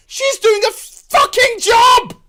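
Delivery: a high, loud, slow yell of exasperation; furious shout. Real, not acted. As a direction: blow up at the screen. Angry rant at the show.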